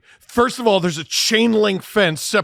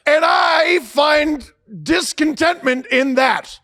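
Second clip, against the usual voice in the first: slow mock fan shout; 7 semitones higher, slower than usual. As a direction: heckle the team with fake formality. Imitating booing fans.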